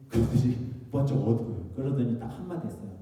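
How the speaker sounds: ordinary delivery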